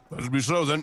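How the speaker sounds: ordinary talk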